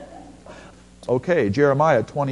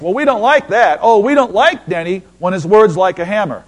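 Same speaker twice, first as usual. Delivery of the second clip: loud, higher, sulky crowd voice; mock church complaint; 4 semitones higher, 6 dB louder than usual. Preacher mocking the congregation's dislike of hammer words. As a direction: pout like a church that only wants fire. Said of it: "you know I'm setting you up".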